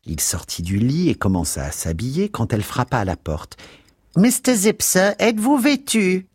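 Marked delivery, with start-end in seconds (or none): 4.2-6.3 high, loud busybody charwoman voice; Mrs Biggs voice. A narrator's reading. Charwoman at the door calling the lodger, high and loud. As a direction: pitch her up high and nosy at the door.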